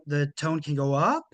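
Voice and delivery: "up" rises at the end like a question; rising tune demo. Shown as a demonstration. Coach showing executives an uptalk ending, rising on "up". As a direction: let "up" climb at the end as if asking.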